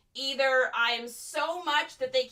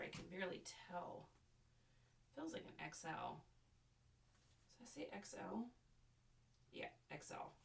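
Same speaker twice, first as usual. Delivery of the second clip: muttering; 4 semitones lower, 25 dB quieter than usual. Real, not acted. Reading tag to self.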